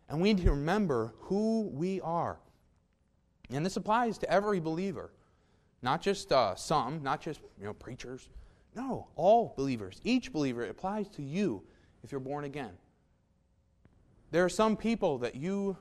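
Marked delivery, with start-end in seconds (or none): none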